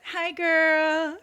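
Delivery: chirpy mock greeting; mock cheery greeting. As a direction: greet her sweetly, playing innocent. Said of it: "I'm like this".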